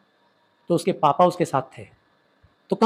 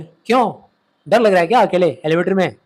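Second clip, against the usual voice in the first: raised, louder scolding question; shouting father; 5 dB louder than usual. Acted. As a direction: snap at your son as an irritated dad. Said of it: "तो चिल्ला के बोले". (चिल्लाकर डाँटते पापा)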